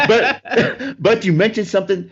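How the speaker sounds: high laughing words. Real, not acted. Host still laughing at the pool story, laughing. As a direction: talk through a real laugh.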